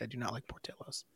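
whispered confession. Real, not acted. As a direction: whisper it like a secret. Quiet confession aside.

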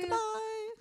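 very high, sung-out goodbye; sing-song goodbye. Real, not acted.

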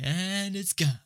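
high-pitched cartoon mimicry; meme imitation. Looking at the funny gif, high meme imitation. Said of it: "looking at this gif now".

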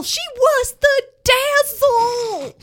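grand melodramatic swoon; soap-opera tribute. Acted. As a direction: overplay it like a tearful eulogy. Dramatic tribute line.

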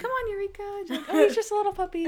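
very high, sweet child imitation; sweet little girl. Little girl at the motel calling her puppy, high and sweet. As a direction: put on a tiny, sweet girl's voice. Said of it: "sweet voice".

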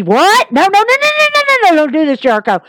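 a fan frantically pleading with Jericho to stay, high and urgent; mock panicked fan. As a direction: beg Jericho not to quit, voice climbing high. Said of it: "you're like me".

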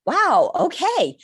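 high, slow, stunned wow; awed surprise. Retold or imitated. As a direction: let the news land in awe. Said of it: "I was like".

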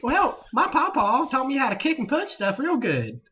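high laughing hillbilly twang. Retold or imitated. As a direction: boast like a country boy. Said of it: "The redneck voiceover".

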